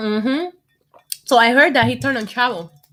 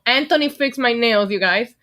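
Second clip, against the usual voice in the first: ordinary talk, nothing marked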